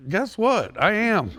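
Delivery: proud high-pitched punchline; triumphant. Real, not acted.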